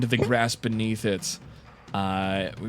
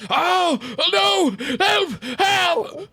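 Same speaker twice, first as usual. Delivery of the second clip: high, panicked cry for help; screaming farmer; 4 dB louder, much slower than usual. Acted. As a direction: scream for help while being mauled. Farmer screams as werewolf attacks.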